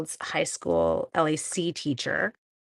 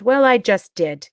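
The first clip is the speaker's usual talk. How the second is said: defiant; 10 dB louder, slower than usual. Real, not acted. Defiant answer to imagined critics.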